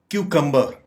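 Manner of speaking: "क्यूकम्बर" said KYU-kum-bur; correct pronunciation. Shown as a demonstration. Teacher modelling the word, "क्यूकम्बर" as KYU-kum-bur. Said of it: "इसकी दुरुस्त pronunciation है".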